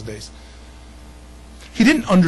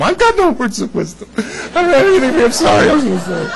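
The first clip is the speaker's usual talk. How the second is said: laughing mock apology; 8 semitones higher, 5 dB louder than usual.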